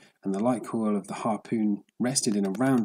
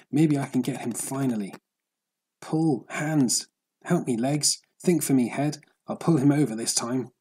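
higher and louder, rallying his own body; straining, urging himself on; 4 semitones higher, 4 dB louder than usual. Acted. Worn-out fisherman commanding his own body to pull: higher, louder urging. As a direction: spur on tired hands and legs.

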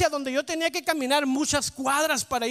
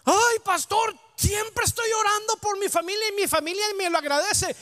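higher-pitched mock lament to the pastor; complaining churchgoer; 3 semitones higher than usual. Churchgoer grumbling to pastor about thankless family, mock complaint. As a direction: imitate a put-upon church member complaining.

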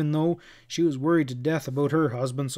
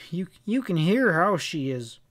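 usual speaking voice

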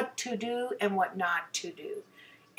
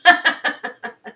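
loud squealing laugh; high laugh; 7 semitones higher, 9 dB louder than usual. Real, not acted. Laughing at her own dolphin joke, high and loud.

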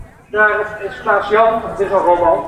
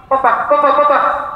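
high, loud scream for papa; screaming child; 8 semitones higher, 6 dB louder than usual. A narrator's reading. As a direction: scream out in pain for father. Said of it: "Ik schreeuw nog harder". (kind schreeuwt hoog en luid om papa)

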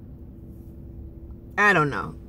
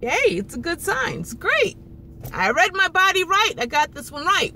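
excited hope; 9 semitones higher than usual. Retold or imitated. Recalling false hope, cheering herself.

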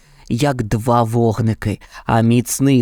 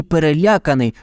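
plain reading voice

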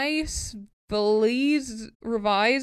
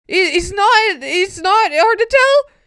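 indignant outburst; 11 semitones higher, 9 dB louder than usual. Real, not acted. Arguing with the poem.

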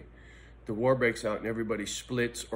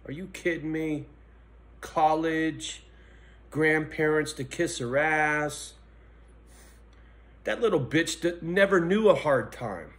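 rising to a louder, higher furious rant; loud, higher-pitched venting about the daughter; angry rant; 5 semitones higher, 6 dB louder than usual. Real, not acted. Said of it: "I'm trying to rein it in a little bit".

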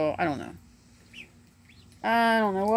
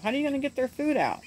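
quick, higher husband's question; mock husband; 7 semitones higher, faster than usual. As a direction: rattle off the husband's doubt. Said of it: "My husband says".

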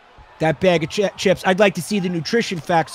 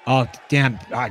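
usual speaking voice

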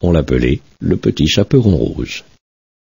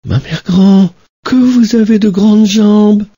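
put-on high, amazed little girl; wondering girl; 3 dB louder than usual. Little girl in bed marvelling at grandmother, high. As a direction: marvel at her arms.

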